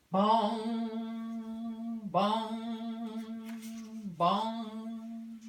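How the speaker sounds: loud, long bell tolls; imitated bell bong. Church bell ringing under the nest, loud bongs. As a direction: ring them out like a big bell.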